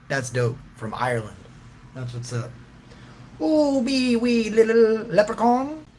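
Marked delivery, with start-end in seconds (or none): 3.4-5.9 high, slow, squeaky little leprechaun. Pretend. Voicing a tiny leprechaun.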